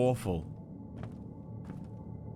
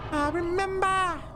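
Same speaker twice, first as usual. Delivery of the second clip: high squeaky imitation; 12 semitones higher than usual. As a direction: pitch way up, mimic a game voice.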